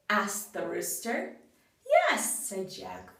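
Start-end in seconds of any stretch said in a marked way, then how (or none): 1.9-2.5 bright high-pitched yes; Jack's high yes. Acted. Jack cheerfully agreeing to the cat, high pitched. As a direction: pop the yes up high and light.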